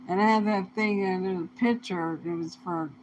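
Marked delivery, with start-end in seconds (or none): none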